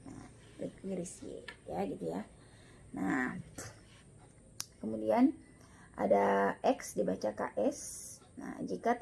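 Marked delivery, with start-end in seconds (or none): none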